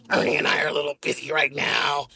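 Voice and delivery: very deep, sulky grumble; low and put out, no mood to talk; grumpy Bert. Pretend. Bert refusing to talk to anybody, deep and sulky.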